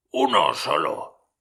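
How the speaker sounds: mucho más grave y lento; Scrooge corrige. A narrator's reading. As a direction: corrige en voz baja y pausada. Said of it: "repuso Scrooge". (Scrooge corrige al espectro, grave y lento)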